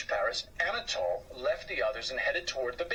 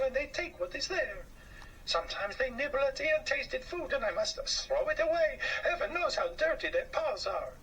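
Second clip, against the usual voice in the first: higher, fussy housewife voice; a complaining French woman, pitched up; 10 semitones higher than usual. Acted. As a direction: whine about the mice like a fussy lady. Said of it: "complained a woman".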